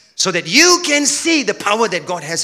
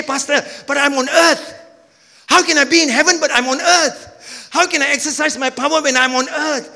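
questioning churchgoer; 7 semitones higher than usual. Acted.

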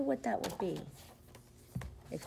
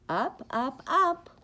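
climbing higher on each up; rising up; 7 semitones higher, 3 dB louder than usual. Acted. Reader voices the truck going up, rising.

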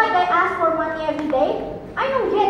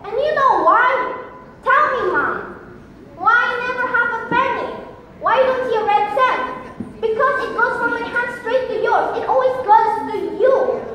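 raised, higher voice full of grievance; angry outburst; 5 semitones higher than usual. Acted. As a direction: let pent-up resentment pour out at Mom. Acted outburst at mother over money.

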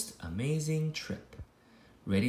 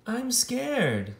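higher, timid frightened voice; a scaredy cat admitting fear; 7 semitones higher than usual. Acted. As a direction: sound small and afraid.

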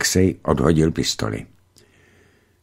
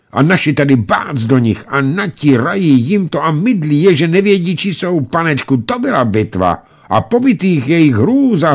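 put-on high, animated child storyteller; excited boy recounting; 7 semitones higher, 3 dB louder than usual. A narrator's reading. Boys tell the pilot about the battle, excited. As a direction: tumble through the tale at a raised pitch.

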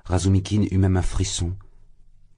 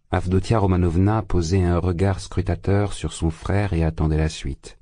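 ordinary delivery, nothing marked

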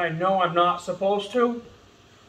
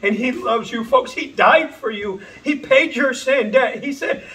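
higher, louder, impassioned preacher climax; full-force preaching; 5 semitones higher, 6 dB louder than usual. Real, not acted. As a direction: drive it home to the crowd.

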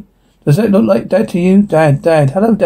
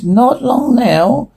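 high, slow demon croon; demonic voice; 7 semitones higher, much slower than usual. Acted. Demon answering the exorcist, demonic voice. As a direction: stretch it out high, sliding upward. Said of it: "Demonic voice".

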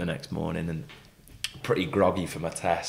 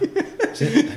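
ordinary talk, nothing marked

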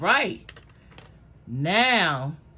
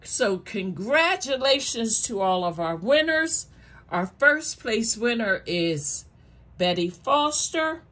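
hyped giveaway announcement; 5 semitones higher than usual. Real, not acted. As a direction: build suspense, then announce the winner. Announcing first place winner.

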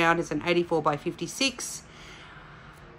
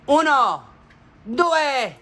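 dramatic countdown; 9 semitones higher, 6 dB louder, slower than usual. Pretend. Dramatic reveal countdown in Italian.

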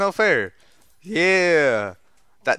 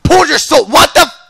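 loud, high outburst; yell, breaking off baffled; frantic yell; 9 semitones higher, 6 dB louder than usual. Real, not acted. Gamer whose soul pull fails, frantic yell. Failed attack in fight.

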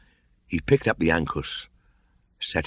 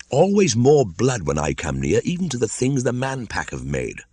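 disgusted; 7 semitones higher than usual. Acted. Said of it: "said Mowgli disgustedly".

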